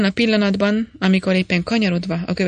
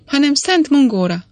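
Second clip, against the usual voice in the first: higher-pitched, lightly exclaimed oath; exclaiming; 7 semitones higher, 3 dB louder than usual. A narrator's reading. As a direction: swear by the saint with lively emphasis. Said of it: "kiáltott föl".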